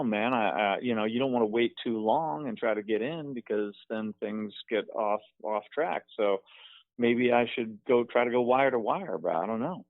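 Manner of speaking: normal talking voice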